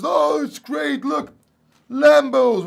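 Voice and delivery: high, loud mock hype; hyped YouTuber. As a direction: sell the Lambo dream like a hype YouTuber. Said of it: "and all these big YouTubers".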